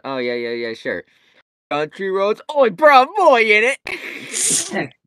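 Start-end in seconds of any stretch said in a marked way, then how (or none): 1.7-4.9 high, loud mock British shouting; hammed-up British lad, voice pitched way up; British accent. Asked to do Country Roads, hammed-up British accent. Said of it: "country roads in a British accent".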